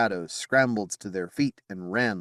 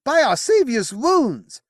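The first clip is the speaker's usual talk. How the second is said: much higher, louder and slow; frustrated oath; 8 dB louder, slower than usual. Acted. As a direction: swear it out in fury.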